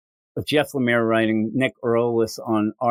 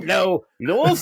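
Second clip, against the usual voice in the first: slow, higher mock Liverpool accent; bad Liverpool accent; 7 semitones higher, slower than usual. Jim attempting to greet Liverpool, bad Liverpool accent. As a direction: drag out a badly faked Liverpool greeting. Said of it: "That is not a Liverpool accent".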